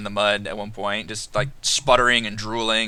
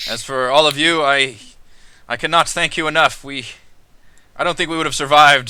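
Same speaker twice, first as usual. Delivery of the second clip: grateful captain; 4 semitones higher, 7 dB louder than usual. Acted. GM voices Captain Solana.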